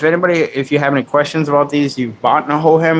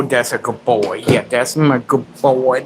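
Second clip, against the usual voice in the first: ordinary talk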